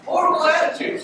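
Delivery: ordinary talk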